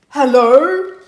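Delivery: very loud gruff bear hello; big storybook bear voice; gruff, grisly voice. A narrator's reading. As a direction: growl a booming hello like a grumpy bear. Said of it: "says a gruff, grisly voice".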